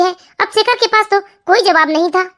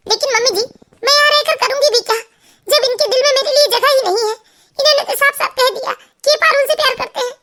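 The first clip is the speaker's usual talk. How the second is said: higher-pitched, hurt protest; despairing daughter-in-law; 5 semitones higher than usual. Acted. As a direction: protest hopelessly to your mother-in-law in a high voice. Narrator plays despairing daughter-in-law. (निराश बहू, ऊँचे दुखी सुर में)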